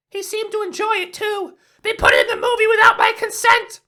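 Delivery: very high squeaky voice, building to a screaming laugh; a cartoon gun losing it, from sweet to manic threat; high-pitched voice cracking into maniacal shouting; unhinged gun character. Gun interviewed by Antoine, unhinged rant.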